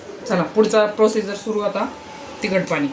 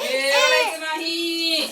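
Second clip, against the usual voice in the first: soothing; 9 semitones higher, much slower than usual. Real, not acted. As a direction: stretch it out gently to calm her. Soothing a crying baby.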